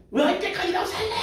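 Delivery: high, jeering yell at a wayward wife; mock angry husband. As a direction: play the furious husband, hammed-up. Husband yelling at unfaithful wife. (화난 남편 흉내, 높게 비꼬며)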